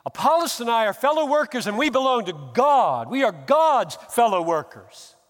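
loud, high, emphatic paraphrase; paraphrasing Paul. Retold or imitated. Preacher voicing Paul's meaning to the church, loud and high. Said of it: "I think he means".

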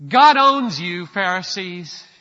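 loud, rising proclamation; full-force preaching. Acted. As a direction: pound each line home to the congregation.

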